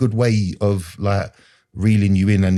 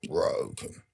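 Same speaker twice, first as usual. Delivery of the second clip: breathy whisper, much softer, trailing away; whispered echo; 3 semitones lower, 10 dB quieter than usual. Real, not acted. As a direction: let the word die out in a broken whisper. Crying on the motorway.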